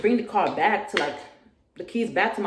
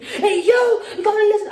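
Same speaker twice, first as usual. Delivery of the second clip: much higher, louder yell to the room; 9 dB louder, faster than usual. Retold or imitated. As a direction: shout to get everyone's attention. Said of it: "I just started yelling".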